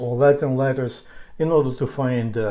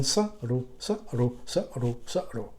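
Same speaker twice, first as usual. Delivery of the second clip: saru repeated low and falling, ever softer; changed starting pitch; 4 semitones lower, 8 dB quieter than usual. Shown as a demonstration. Teacher drilling pitch variations, low falling saru fading away. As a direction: start each saru low and let it fade. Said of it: "we can change the pitch of".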